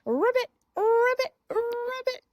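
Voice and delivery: high, quick ribbit; frog croak. Retold or imitated. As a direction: ribbit fast, like a frog hopping away. Frog hops about.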